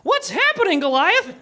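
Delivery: mock-frightened boy, pitched high; high, lightly acted fright. A narrator's reading. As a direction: ask it as a scared little boy. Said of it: "muttered a frightened Davy".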